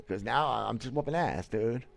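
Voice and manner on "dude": ordinary talk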